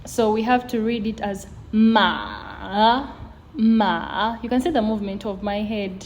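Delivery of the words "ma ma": "ma" dipping down, then rising; valley tone. Shown as a demonstration. Teacher reading the third tone for learners, valley tone. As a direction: drop the pitch of "ma" and bring it back up. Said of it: "it falls and then it gets to rise".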